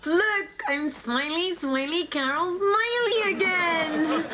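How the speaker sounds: high, slow beaming exclamation; delighted. Acted. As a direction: show off the new smile.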